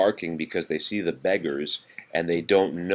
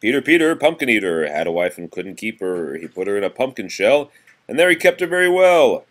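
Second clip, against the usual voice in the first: lively sing-song nursery rhyme reading; reading to children; 4 semitones higher, 7 dB louder than usual. Acted. Parent reading seasons rhyme to a toddler, sing-song. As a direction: read it bouncy for a small child.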